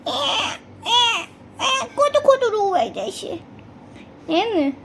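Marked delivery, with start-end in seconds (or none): 0.1-3.4 high, quick pretend crying whine; mother playfully fakes a teary whine; mock whimper. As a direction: fake a little cry for the baby. Mother mimics being upset.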